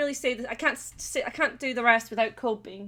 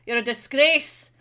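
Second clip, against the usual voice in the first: mock outrage; 6 dB louder than usual. Recalling a telling-off about an Englishman, mock outrage. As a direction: scold like an outraged patriot, hammed up. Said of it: "I was".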